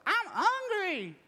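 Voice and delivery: put-on high, slow childish whine; whiny five-year-old. Kid in the car whining to dad, high and drawn out. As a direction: whine like a hungry little kid. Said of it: "five-year-old who's saying what".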